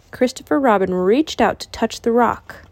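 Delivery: ordinary delivery, nothing marked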